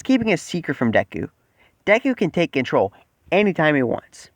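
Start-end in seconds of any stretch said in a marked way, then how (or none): none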